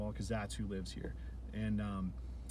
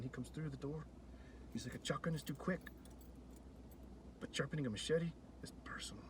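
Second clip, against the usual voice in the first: put-on lightly done Salvadoran accent, softer, ending in a whisper; 8 dB quieter than usual. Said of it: "El Salvadorian accent I can come up with".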